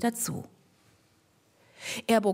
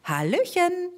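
much higher, twittering hello; a cheeky bird chirping hello; the bird cheerfully greeting from above; chirpy bird greeting; 9 semitones higher than usual. Acted. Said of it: "zwitscherte er".

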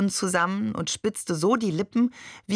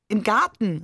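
louder, higher protesting call; 4 semitones higher, 5 dB louder than usual. A narrator's reading. Shakir calling out to Babe in protest, louder. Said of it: "rief Shakir".

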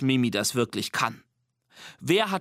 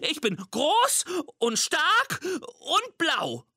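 much higher and slow, a tiny monster puffing itself up; squeaky boast; 14 semitones higher, much slower than usual. Acted.

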